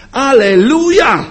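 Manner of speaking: high, loud cry of sudden relief; Paul bursting out in praise; joyful hallelujah. Retold or imitated. Paul after a revelation, shouting joyful praise. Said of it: "przeżywa objawienie i mówi".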